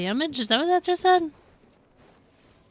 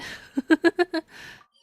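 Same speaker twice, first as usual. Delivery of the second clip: loud, high giggle; real laugh; 6 semitones higher, 5 dB louder than usual. Real, not acted. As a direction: burst out in quick high giggles. Amused after missing game text.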